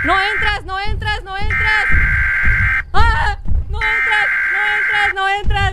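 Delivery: loud, high, rapid-fire repeated yells; shrieked refusal in a panic; panicked shouting. Real, not acted. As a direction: yell frantically to keep it out. Monster trying to get in.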